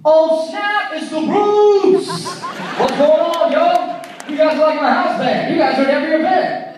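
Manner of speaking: loud, high-pitched hammed-up delight; excited greeting. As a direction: hail your favorite band like an excited buddy. Imagined casual president greets band excitedly.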